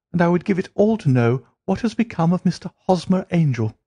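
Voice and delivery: high, earnest voice of a worried client; Mary begging Holmes to find her fiancé, high and earnest; pleading young woman. Acted. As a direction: beg the detective for help.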